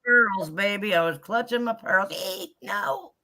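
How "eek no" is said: high little squeak, then no; a hint of real fright; mock eek. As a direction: squeal like a startled mouse. Showing her fright on rides.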